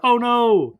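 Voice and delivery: exaggerated oh-no; mock dismay. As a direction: echo the bad news in cartoon shock. April hears the bad news, retold.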